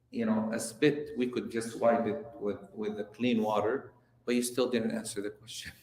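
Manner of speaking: a composed scholar keeping his cool; calm reply. Retold or imitated. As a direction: answer the insult with quiet calm. Scholar calmly answers insult.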